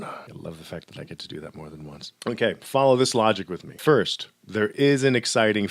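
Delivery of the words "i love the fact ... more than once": low, much softer confiding aside; quiet aside. Real, not acted. Lecturer confiding enjoyment of the voice, quiet aside. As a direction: drop right down, as if to yourself.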